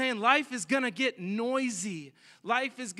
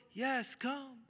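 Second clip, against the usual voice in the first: high, soft far-off voice; distant call; 4 semitones higher, 8 dB quieter than usual. Acted. Jesus calls Peter from way out, distant call. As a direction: call faintly from a long way out. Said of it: "way out in the distance".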